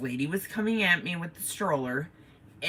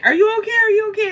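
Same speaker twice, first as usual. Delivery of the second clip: crowd asking frantically; 8 dB louder, much faster than usual. Retold or imitated. Crowd asking after crash.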